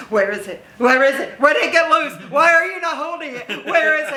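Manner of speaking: hammed-up panicky crowd asking after the puppet; frantic fans. Fans demanding where the puppet went, mock frantic.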